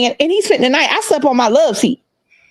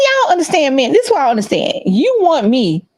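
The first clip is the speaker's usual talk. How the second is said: usual speaking voice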